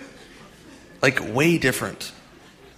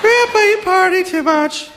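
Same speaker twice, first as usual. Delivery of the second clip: put-on much higher kid voice, laughing; grandson's voice. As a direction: tease grandpa in a high little-boy voice. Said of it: "My grandson's gonna be like".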